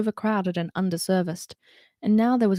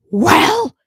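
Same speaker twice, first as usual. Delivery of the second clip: louder, higher clipped demand; 9 semitones higher, 11 dB louder than usual. Acted. Root demanding why Holly is late, loud. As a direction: demand an answer sharply. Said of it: "he demanded".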